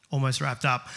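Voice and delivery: ordinary delivery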